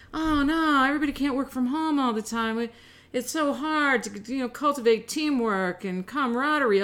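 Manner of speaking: high, whiny parody of bosses' excuses; mocking corporate voice.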